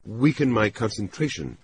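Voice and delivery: high, careful woman's voice; tentative high-voiced explanation; cautious. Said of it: "she said cautiously".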